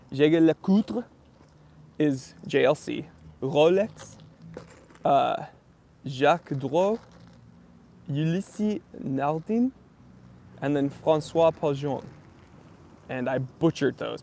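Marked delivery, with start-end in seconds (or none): 0.1-12.0 hammed-up French accent on the brand names; bad French accent, slow and sing-song. Shown as a demonstration. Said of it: "with your best French accent".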